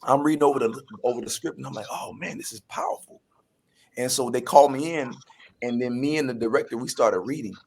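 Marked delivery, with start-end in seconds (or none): 1.9-3.2 retold reaction, breathy and hushed; quietly awestruck, barely voiced; hushed awe. Actor recalls reading a powerful script, hushed awe.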